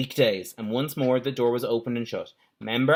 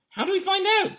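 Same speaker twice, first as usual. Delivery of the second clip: put-on high puzzled girl. Pam asking in child voice.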